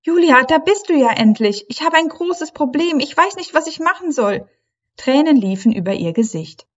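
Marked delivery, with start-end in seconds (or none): 0.0-4.5 hoch, aufgeregt und hastig rufend; aufgeregter Ruf. A narrator's reading. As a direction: ruf ihr aufgeregt und verzweifelt zu, mit hoher Mädchenstimme. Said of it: "Aufgeregt rief Leilani". (aufgeregt, hoch und laut rufend)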